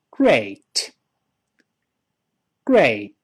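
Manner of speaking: great with a long "ay" and light final t; correct vowel. Shown as a demonstration. Teacher leading repetition, great with "ay" and light t. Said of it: "这是发a".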